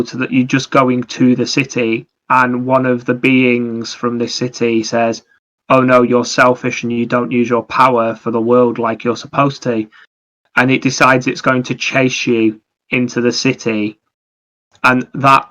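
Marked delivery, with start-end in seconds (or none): none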